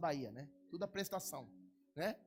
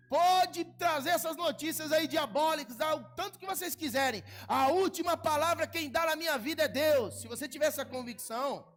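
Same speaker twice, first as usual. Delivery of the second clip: loud, high, triumphant preaching; full force preaching; 6 semitones higher, 7 dB louder than usual. Acted. Pastor rallying the congregation, high loud preaching. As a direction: lift the church with a ringing cry.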